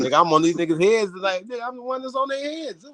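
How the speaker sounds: very high, squealed disbelief; high-pitched exasperation. Acted. Man hyping himself up to fight his critics, high-pitched exasperation. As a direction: voice climbs into a comic falsetto-like whine. Hyped mock outrage at being targeted.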